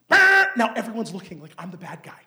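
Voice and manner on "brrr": loud, high mock alarm buzz; scanner buzz. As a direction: buzz it out like the machine going off. Mimics the airport scanner alarm.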